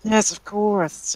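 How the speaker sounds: put-on high, slow old lady voice; high, drawn-out, soft old woman; old lady shopkeeper. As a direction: agree softly as an elderly woman. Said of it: "burp in the old lady voice".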